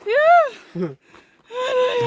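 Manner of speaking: tearful. Real, not acted. Emotional arrival at summit camp.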